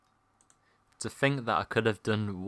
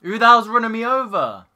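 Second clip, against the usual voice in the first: loud, high outraged yell; angry shout; 13 semitones higher, 12 dB louder than usual. Real, not acted. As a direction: shout at whoever hits you. Run over in game.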